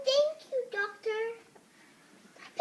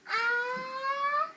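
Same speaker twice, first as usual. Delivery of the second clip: long, loud "ah" climbing high; pre-sneeze gasp; 9 semitones higher, 8 dB louder than usual. Acted. Gerald about to sneeze as friend guesses, pre-sneeze gasp. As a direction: swell towards a huge mock sneeze.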